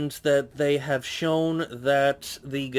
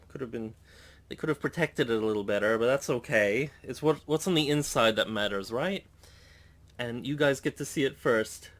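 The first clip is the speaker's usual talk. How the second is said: ordinary talk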